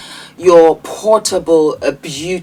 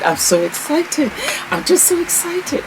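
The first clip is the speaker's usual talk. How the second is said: excited; 8 semitones higher, 6 dB quieter than usual. Real, not acted.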